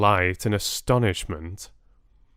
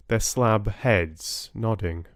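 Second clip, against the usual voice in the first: plain reading voice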